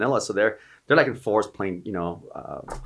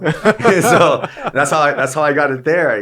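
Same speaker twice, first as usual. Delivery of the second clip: laughing; 8 semitones higher, 11 dB louder, slower than usual. Real, not acted. Laughing through story ending.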